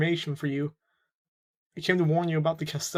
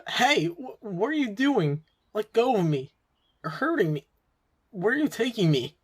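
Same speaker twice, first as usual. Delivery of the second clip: alarmed protest; 5 semitones higher than usual. Acted. Kid grabbed by gangsters demands release, alarmed protest. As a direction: protest as you're hauled away against your will.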